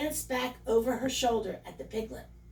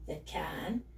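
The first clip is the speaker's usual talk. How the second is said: ordinary delivery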